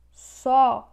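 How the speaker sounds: "Só" punched out loud; forceful stressed syllable. Shown as a demonstration. Teacher naming the strongest syllable, forceful stress. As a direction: hit "Só" hard, on its own. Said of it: "a sílaba que tem mais força".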